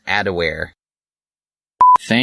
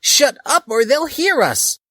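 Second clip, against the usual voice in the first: much higher, louder hushed panic; high urgent yell; 4 dB louder than usual. Acted. Dali warning the hiding group, a high urgent yell. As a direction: snap it urgently at the others. Character shushing hiding friends.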